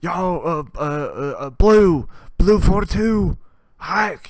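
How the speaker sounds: loud, high football snap count shouted at teammates; mock quarterback call. Joking about soldiers, shouting a football play call loudly.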